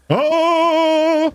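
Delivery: long, high held "oh" yell; demonstration scream. Shown as a demonstration. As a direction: test the mic with a high holler. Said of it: "I need to do something like".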